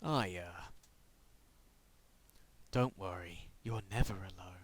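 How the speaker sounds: low, soft sinister whisper; creepy whisper. Acted. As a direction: breathe it like a creepy father. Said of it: "That sounded creepy then".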